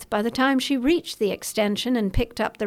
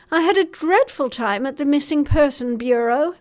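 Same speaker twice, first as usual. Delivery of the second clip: higher, fretful society lady; an upset mother pouring out her worry; anxious mother; 4 semitones higher, 4 dB louder than usual. Acted. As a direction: Clarissa on the phone, fretful and higher. Clarissa voiced in dialogue.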